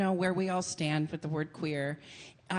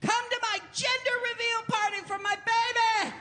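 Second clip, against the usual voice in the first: much higher, bubbly party-host voice; excited party host. Retold or imitated. Hosting her own gender reveal party, high and bubbly. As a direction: gush like a thrilled parent-to-be.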